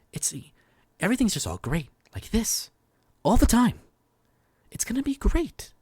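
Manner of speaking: high, loud, stilted overacting; mock unnatural movie-character cheer, pitch soaring; affected movie dialogue. Shown as a demonstration. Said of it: "affectations in people's voices".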